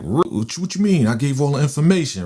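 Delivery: agitated protest. Retold or imitated. Told Saint is back on the street, agitated protest. Acts out startled protest at news.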